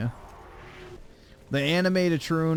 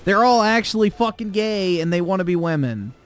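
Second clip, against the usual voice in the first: loud ranting; 4 semitones higher, 5 dB louder than usual. Real, not acted. Heated rant about anime fans.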